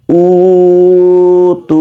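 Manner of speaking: উ তু, each held long; both vowels elongated. Shown as a demonstration. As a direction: stretch উ, then stretch তু equally. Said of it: "দুইটাতেই টান দিব". (উ তু দুটোই টেনে পড়া)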